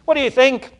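loud, high-pitched theatrical challenge; histrionic demand. Retold or imitated. High priest demanding the court's verdict on Jesus, histrionic. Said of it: "histrionic demonstration".

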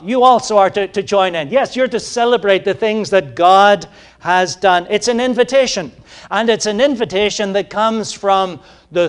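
loud, high exhortation, calling them to join in; full-force preaching. Acted. Preacher urging the congregation to praise, loud exhortation. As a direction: lift it up and urge them on.

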